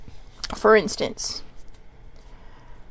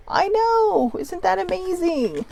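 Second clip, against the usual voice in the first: high, slow amazement; amazed; 10 semitones higher, slower than usual. Real, not acted. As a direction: gush with wonder. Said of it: "speaker marvels".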